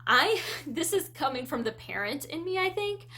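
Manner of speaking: normal talking voice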